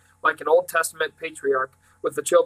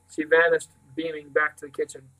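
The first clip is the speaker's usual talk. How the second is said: ordinary delivery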